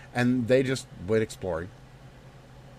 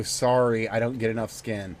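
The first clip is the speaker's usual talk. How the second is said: ordinary talk, nothing marked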